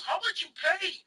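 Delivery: high-pitched street seller impression; Chinese vendor. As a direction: mimic the vendor, pitched well up. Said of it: "he goes".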